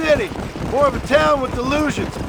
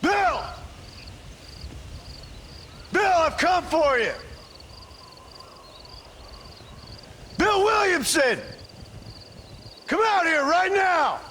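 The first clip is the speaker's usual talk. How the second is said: calling out; 4 semitones higher, 5 dB quieter than usual. Acted. Calling a hiding outlaw out.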